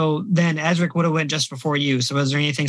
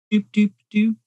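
doop; 6 semitones higher than usual. Acted. GM dice or effect sound.

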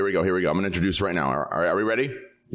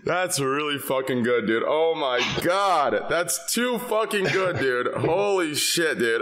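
laughing; 5 semitones higher, slower than usual. Real, not acted. Host laughing at zombie impression.

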